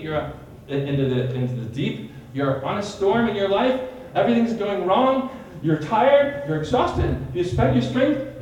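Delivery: ordinary talk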